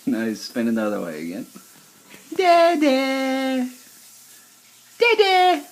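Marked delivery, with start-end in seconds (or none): 2.4-5.6 high bright calls of dada. Real, not acted. Parent coaxes the baby to say dada, high and excited.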